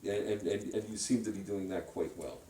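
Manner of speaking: ordinary talk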